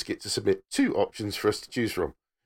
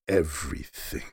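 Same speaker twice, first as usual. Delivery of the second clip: deep dramatic reveal; 11 semitones lower, 5 dB quieter than usual. Acted. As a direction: drop low and soft.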